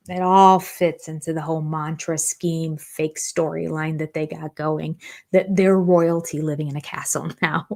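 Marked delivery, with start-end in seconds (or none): none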